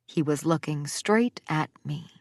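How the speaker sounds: ordinary delivery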